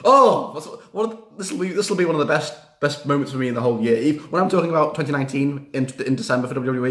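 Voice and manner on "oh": loud, high gasping oh; excited oh. Real, not acted. Fan recalling the moment, loud excited oh. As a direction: burst out with glee.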